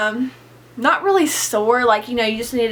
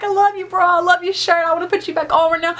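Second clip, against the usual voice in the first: higher pitched, playful pining for her clothes; mock longing; 7 semitones higher than usual. Missing her bra while topless at the piercer, higher mock longing. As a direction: plead with your bra like a lost love.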